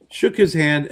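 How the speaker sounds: ordinary delivery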